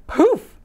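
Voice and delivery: high sudden magical poof. Acted. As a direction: pop it like a magic trick. Magic transformation sound effect.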